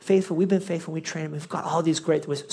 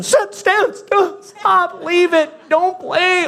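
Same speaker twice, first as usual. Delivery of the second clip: loud, high shouted dog commands; big voice; 12 semitones higher, 5 dB louder, much slower than usual. Retold or imitated. Owner giving his runaway dog every command, big voice. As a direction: bellow orders at a dog that won't listen. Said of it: "I'm doing the big voice".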